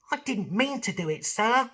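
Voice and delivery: young cartoon voice. Shown as a demonstration. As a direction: sound like a young cartoon boy. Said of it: "then I'd do something like this".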